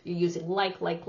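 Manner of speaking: usual speaking voice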